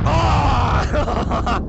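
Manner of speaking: loud high cry of surprise; startled yell. Real, not acted. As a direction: yelp as you get hit. Killed suddenly in game.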